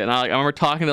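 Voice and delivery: normal talking voice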